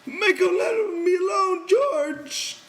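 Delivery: high, loud, panicked plea; terrified pleading shout. A narrator's reading. Lenny begs George while being beaten, terrified shout. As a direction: beg for rescue in terror. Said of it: "he cried".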